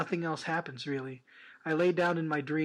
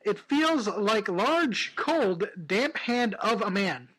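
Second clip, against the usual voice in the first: very slow, louder reading; slow dramatic; 3 semitones higher, 3 dB louder, much slower than usual. Shown as a demonstration. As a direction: drag it out for drama. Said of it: "I'll read that again".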